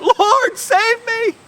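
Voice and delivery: high, loud plea for rescue; crying out. Acted. Sinking fisherman begging the Lord to save him, desperate cry. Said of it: "He cried out".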